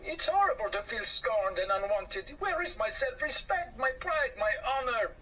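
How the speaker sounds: a mouse mourning his lost honor; indignant hurt. Acted. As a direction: rise in hurt pride. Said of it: "said Anatole".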